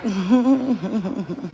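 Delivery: chuckling laugh; a short run of chuckles. Real, not acted. Laughing at the chat.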